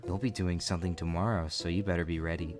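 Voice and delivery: low, soft sultry teacher voice; creepy teacher voice. Acted. Vlogger reads teacher's creepy message aloud, low and soft.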